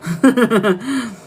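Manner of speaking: short frustrated laugh; exasperated laugh. Real, not acted. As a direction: laugh it off, annoyed. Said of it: "scream into the pillow".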